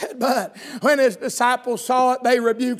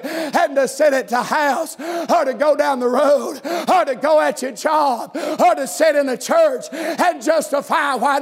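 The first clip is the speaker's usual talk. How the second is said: full-force sermon cadence, driving each phrase home; country revival preacher in full flow; preaching; 3 semitones higher, 3 dB louder than usual. Real, not acted. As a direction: preach it hard in rhythmic bursts.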